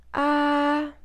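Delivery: ah held high and level; a high flat ah, first tone. Shown as a demonstration. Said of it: "So the first tone".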